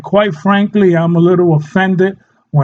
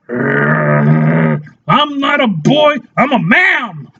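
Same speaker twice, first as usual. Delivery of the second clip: pissed-off dog; 2 semitones lower, much slower than usual. Acted. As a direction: play an offended dog correcting its owner. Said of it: "your dog is gonna get pissed".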